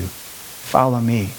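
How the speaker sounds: slow, soft call to follow; gentle invitation. Acted. Jesus calling his sheep home, slow and soft. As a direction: draw them in tenderly.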